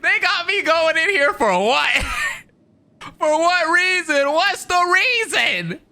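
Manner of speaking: high-pitched, incredulous bro voice through laughter; mock outrage, voice up high and cracking with laughs; imitating Lamine. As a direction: squeak it out in disbelief, slow and stretched. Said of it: "Lamine is just like".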